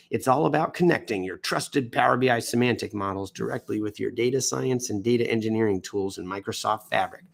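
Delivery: usual speaking voice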